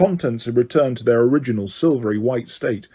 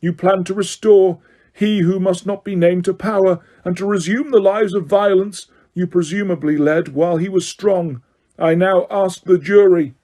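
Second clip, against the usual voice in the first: higher, cold formal sentencing; stern judge Crouch; 6 semitones higher than usual. A narrator's reading. Crouch reading the charges, cold stern judge. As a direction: read the charges without mercy.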